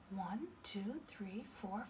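whispered counting; whispering. Shown as a demonstration. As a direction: breathe the numbers softly.

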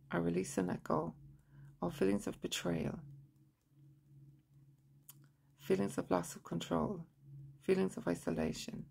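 measured monotone affirmations with long pauses; guided tapping recitation. Shown as a demonstration. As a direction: recite each phrase evenly and quietly, leaving room to repeat.

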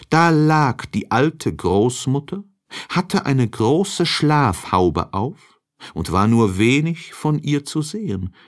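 ordinary delivery

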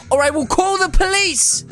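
high, indignant warning; hammed-up threatening cry; mock threat. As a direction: raise the voice in an outraged ultimatum. Threatens player in game.

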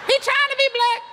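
very high, squeaky falsetto woman; Miss S. Retold or imitated. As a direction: shriek it in mock-scandalized falsetto. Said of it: "That's what she said".

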